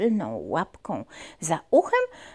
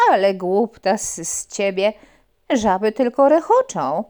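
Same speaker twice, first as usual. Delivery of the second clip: loud teasing frog; 9 dB louder than usual. Acted. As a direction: tease the silly puppy. Frog mocks puppy.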